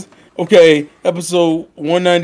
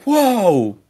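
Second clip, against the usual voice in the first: mock startled Ash; 6 semitones higher, slower than usual. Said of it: "he's like".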